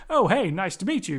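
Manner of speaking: flat American delivery of the greeting; plain American dub. Retold or imitated. Podcaster imitating the American dub actors, plain American. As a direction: say it like a bland American voice-over actor. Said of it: "American a-, actors dubbed over the top".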